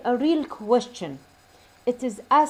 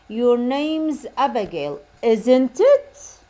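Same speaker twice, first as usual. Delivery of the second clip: slow statement, then "isn't it" louder and rising; rising tag intonation; 5 dB louder, much slower than usual. Shown as a demonstration. Teacher repeating the checking example for students, rising intonation on "isn't it". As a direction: push "isn't it" up into a question. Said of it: "شلون النغمة مرتفعة".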